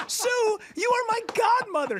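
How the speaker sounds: high, shouted retold disbelief; exasperated insistence. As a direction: re-enact pleading to be recognized. Retold insisting to forgetful godmother.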